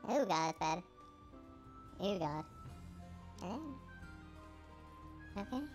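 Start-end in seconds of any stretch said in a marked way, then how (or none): none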